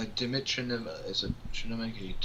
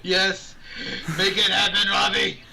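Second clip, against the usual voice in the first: loud, delighted yelling of encouragement; excited cheering; 6 semitones higher, 11 dB louder than usual. Real, not acted. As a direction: cheer him on at full volume. Cheering on the girl voice idea.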